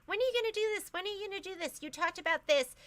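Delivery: high pleading question from an impatient fan; eager viewer asking. Retold or imitated. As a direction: mimic a fan begging for the next project. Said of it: "A lot of you are asking".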